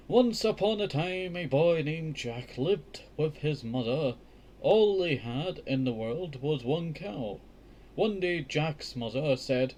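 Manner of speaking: slow, lifted read-aloud voice; careful children's book narration; storybook reading. A narrator's reading. Reads fairy tale aloud.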